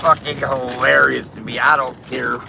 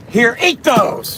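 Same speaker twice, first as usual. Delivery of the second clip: scolding parent; 9 semitones higher, 5 dB louder, slower than usual. Acted. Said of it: "the performance of that skit".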